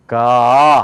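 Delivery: long "가" swelling open from the throat; American two-step "가"; American-style 가. Shown as a demonstration. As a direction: draw out "가" in two openings. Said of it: "틀리죠?".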